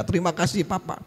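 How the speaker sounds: acted childlike gratitude; delighted child. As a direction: thank Dad like a happy little child. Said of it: "wah seneng dia".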